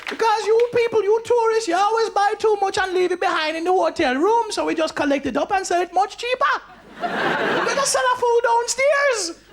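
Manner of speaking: high-pitched Jamaican woman lecturing tourists; hammed-up Jamaican cleaner, far above own voice; old Jamaican cleaning lady. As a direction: explain it shrilly in a Jamaican accent. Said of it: "Old Jamaican cleaning lady".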